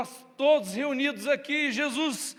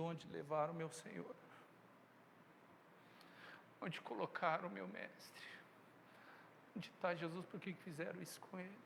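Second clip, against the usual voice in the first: soft, hushed lament, much quieter; grieving lament; 4 semitones lower, 19 dB quieter than usual. Acted. Mary searching for the missing body, hushed grief. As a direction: let the questions fall away softly in grief.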